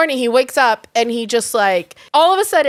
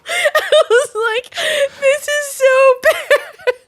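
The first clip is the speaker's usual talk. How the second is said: laugh-crying; 11 semitones higher, slower than usual. Retold or imitated. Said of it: "I just start crying".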